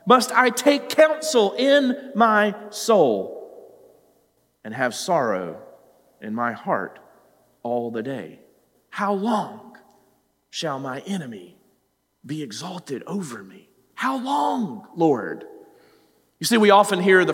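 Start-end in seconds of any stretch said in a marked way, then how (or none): 0.1-3.5 crying out. Retold or imitated. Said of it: "Verse two, he says". 4.6-8.4 dropping low and soft; quiet grieving lament; sorrowful lament. Retold or imitated. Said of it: "Verse two, he says". 8.9-15.4 soft, low, fading plea; a weary, quiet asking; hushed sorrowful prayer; mournful lament. Retold or imitated. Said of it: "Verse two, he says".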